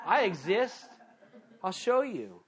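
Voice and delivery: lightly voiced divine rebuke; mock stern answer to the scoffers; God retorting. Preacher voicing God answering scoffers.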